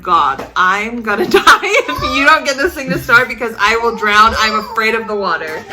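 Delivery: mock panicked prayer. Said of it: "I was saying, like, a thousand prayers".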